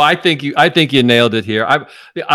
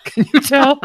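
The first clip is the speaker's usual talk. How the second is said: laughing; 11 semitones higher than usual. Real, not acted. Laughing joke about his hair.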